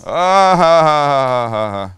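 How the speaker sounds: Ah ha ha, laughed out loud and forced; loud fake laugh. Mock laugh at Max's jab.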